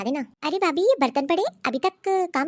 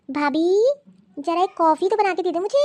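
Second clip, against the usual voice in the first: calling out; 4 semitones higher, 6 dB louder than usual. Acted. Calling out to bhabhi.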